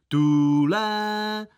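high, loud trumpeting toot of a fire horn; fireman's horn toot. Pretend. Boy playing fireman toots his horn, high and loud. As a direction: blow it like a toy fire horn. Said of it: "troubil jsem".

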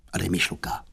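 low, hushed request; quiet aside. Acted. Youth asking brother for a puff, hushed. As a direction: drop to a soft, low aside.

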